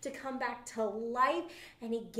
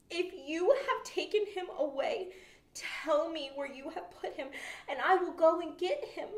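higher, louder pleading woman; Mary pleading; 8 semitones higher, 6 dB louder than usual. A narrator's reading. Mary begs the man she thinks is the gardener, high pleading. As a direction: beg the gardener, pitched up. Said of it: "Mary was standing outside of the tomb crying".

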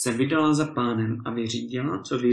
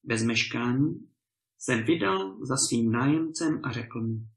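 plain reading voice

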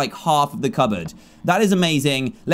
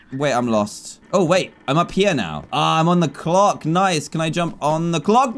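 ordinary talk, nothing marked

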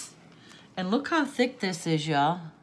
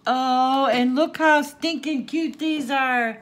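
loud, high cooing over cute things; excited, raised-pitch adoration; gushing delight; 6 semitones higher, 7 dB louder than usual. Real, not acted. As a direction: gush at how adorable it is.

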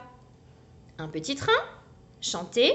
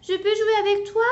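put-on high, louder hopeful child voice; little hamster pleading; shy asking; 10 semitones higher, 4 dB louder than usual. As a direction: ask timidly to play. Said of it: "demande Alistair".

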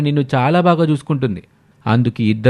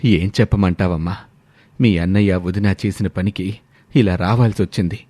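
lower, hurt father voice; aggrieved father; 6 semitones lower than usual. Acted. Father tells daughter why they came, lower hurt voice. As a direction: complain to the daughter wearily.

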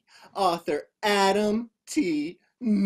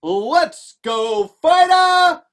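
loud rallying shout, rising higher; crowd cheer; 7 semitones higher, 10 dB louder, much slower than usual. Acted. Crowd started to cheer, loud shout. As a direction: bellow it to get the crowd going. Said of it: "The crowd started to cheer".

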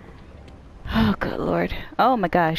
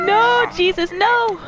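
high, loud scared cry; terrified screaming; 11 semitones higher, 5 dB louder, slower than usual. Real, not acted. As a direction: cry out in terror. Sudden scare in game.

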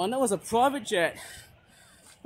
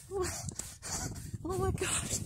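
a slow, breathy whisper to himself; slow whispered oh my gosh under his breath; whispered worry; 10 semitones higher, 8 dB quieter, much slower than usual. Real, not acted. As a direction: hush it out, slow and worried. Worried whisper while hurrying.

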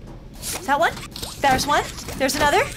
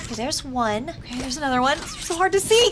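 usual speaking voice

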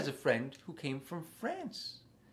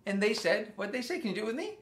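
usual speaking voice

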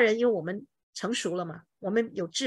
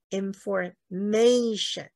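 information as slow in-for-MAY-shun; penultimate stress; much slower than usual. Shown as a demonstration. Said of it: "倒数第二音".

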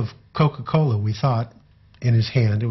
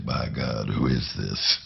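extremely low, softer bass rumble; 12 semitones lower, 5 dB quieter, slower than usual. Retold or imitated. J D laughing, asking who is calling, extremely low bass voice. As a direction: get down as low as the voice will go. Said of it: "get down real low and talk a little bit like J".

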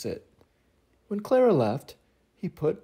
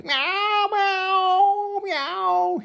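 high, wailing kitten meows; crying kitten meows; 12 semitones higher, much slower than usual. Acted. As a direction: mew like an upset kitten. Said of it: "the kitten began to cry".

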